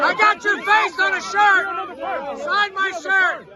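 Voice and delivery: loud fan yell; shouting. Real, not acted. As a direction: yell it over the crowd. Fan yelling at celebrity.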